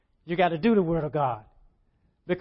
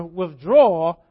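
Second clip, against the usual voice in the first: drawn-out word; 3 semitones lower, 7 dB louder, much slower than usual. Real, not acted. Stretched key word.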